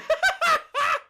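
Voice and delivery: loud, high cackling laugh; real uncontrolled high laughter; cracking up. Real, not acted. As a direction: burst out laughing mid-thought. Cracks up at absurdity.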